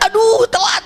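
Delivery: dismayed cry. Retold or imitated. Dismay as lift closes.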